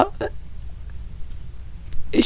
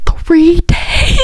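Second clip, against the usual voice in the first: high excited shout about the concert; excited squeal; 8 semitones higher than usual. Real, not acted. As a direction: squeal it with joy.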